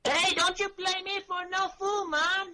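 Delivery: high put-on Jamaican accent; mock Jamaican voice. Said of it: "That was a Jamaican accent".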